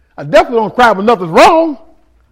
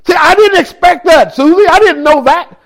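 ordinary talk, nothing marked

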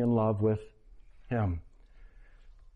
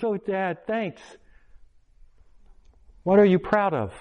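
lightly voiced teenage daughter, higher; a hint of a grateful girl, pitched up; daughter's voice; 5 semitones higher, 5 dB louder than usual. Pastor voices his teenage daughter.

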